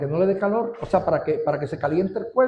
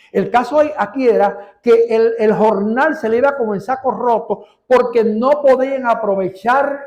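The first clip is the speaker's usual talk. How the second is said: louder, higher pulpit preaching; preaching at full force; 4 semitones higher, 5 dB louder than usual. Acted. Preacher correcting the congregation's reading of the verse, preaching at full force. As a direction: drive the point home to the congregation.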